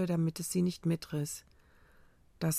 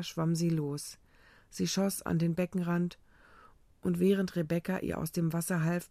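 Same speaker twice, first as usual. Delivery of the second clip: ordinary delivery, nothing marked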